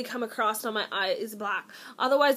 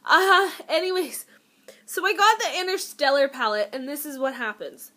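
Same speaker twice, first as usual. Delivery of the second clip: ordinary talk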